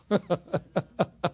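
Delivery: chuckle. Real, not acted. Preacher after mentioning two years in bed, chuckling. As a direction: run of short chuckles.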